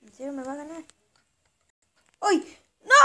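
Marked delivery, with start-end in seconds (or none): 2.2-2.9 shouting. Real, not acted. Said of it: "Perdón por gritar fuerte".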